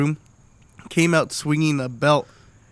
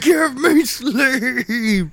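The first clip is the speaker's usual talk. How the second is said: high, slow drawn-out begging; shaking a man while crying out high and slow; desperate mock plea; 10 semitones higher, 5 dB louder, much slower than usual. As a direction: stretch it out, begging for sleep. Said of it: "shaking the dude".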